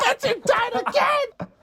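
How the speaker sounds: high, drawn-out mock scream; imitating frustrated screaming. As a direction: scream it like a raging gamer, stretched out. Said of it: "screaming and smashing his fist".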